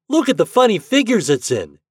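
put-on high, loud boyish delight; excited exclamation. A narrator's reading. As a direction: point it out eagerly to friends. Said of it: "exclaimed Herbie".

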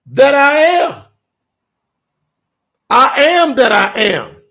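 preacher retelling Yahusha declaring his name, loud and proud; proclaiming I am. Retold or imitated. Booming proclamation of a divine name. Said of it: "he is now flexing on the world".

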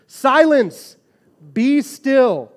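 high, loud command to the storm; commanding Jesus. Acted. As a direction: rebuke the wind with full authority. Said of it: "he stretches out his arms and he says".

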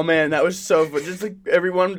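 ordinary talk

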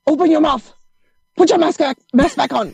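high, brisk imitation of a Russian doctor barking orders; hammed-up Russian accent doctor ordering her patient around; mock Russian doctor. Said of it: "with a really thick Russian accent".

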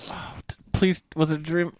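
soft, pleading near-whisper. Retold or imitated. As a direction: hush it as if begging it to be real. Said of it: "I'm like".

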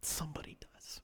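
hushed, voiceless aside; whispered reveal. Acted. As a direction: breathe it as a secret.

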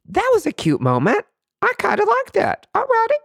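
squealing way up high with delight; giddy delight. Retold or imitated. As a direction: gush like a giddy fan over something cute. Gushing over a sweet moment.

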